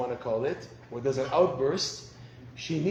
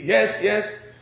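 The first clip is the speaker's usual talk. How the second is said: a higher, louder, eager yes yes; eager wife; 6 semitones higher, 8 dB louder than usual. Retold or imitated. Wife confirms husband got it right, higher and louder. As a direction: confirm it gladly.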